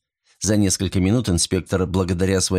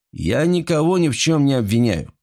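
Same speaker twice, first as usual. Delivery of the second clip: ordinary delivery, nothing marked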